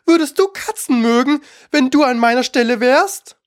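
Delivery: high indignant squeal of outrage; screeching, furious. A narrator's reading. Furious mouse answers Alice about cats, screeching. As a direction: shriek it as an offended mouse. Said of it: "schrie die Maus mit kreischender, wütender Stimme".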